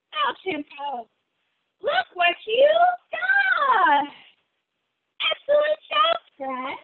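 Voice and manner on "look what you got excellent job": high, bright cheering; excited praise. Real, not acted. Teacher cheers a little yogi's pose, excited and high.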